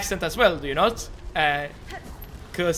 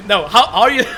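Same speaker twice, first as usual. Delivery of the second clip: louder question breaking into a laugh; amused, laughing stumble; 5 semitones higher, 5 dB louder than usual. Real, not acted. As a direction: ask it helplessly through laughter. Laughing over how to say jump.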